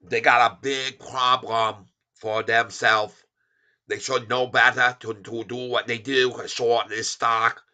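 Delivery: lightly mocking Chinese accent in broken English. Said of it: "make fun of people talk like this".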